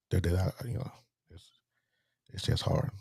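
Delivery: breaking into a whisper; words fade into breath, fighting tears; choked up. Real, not acted. Son missing his late mother, voice breaking to a whisper. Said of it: "not gonna get choked up".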